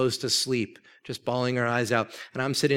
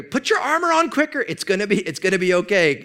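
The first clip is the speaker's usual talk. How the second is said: much higher, urgent hurry-up pleading; frantic reassurance; 7 semitones higher, 5 dB louder than usual. Retold or imitated. King's men hurry the panicked intern, high and urgent. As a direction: rush him along while insisting all is fine.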